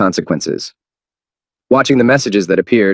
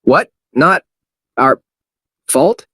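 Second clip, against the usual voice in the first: puzzled; 6 semitones higher, slower than usual. Shown as a demonstration. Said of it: "managed to read what it said".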